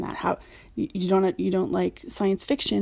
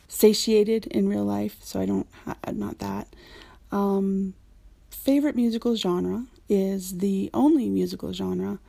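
usual speaking voice

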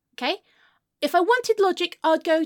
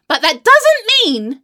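loud, slow, raised pitch; exasperated shout; 4 semitones higher, 10 dB louder than usual. Acted. Insisting to friend the hairdresser is evil, exasperated shout. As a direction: boom each word to override them.

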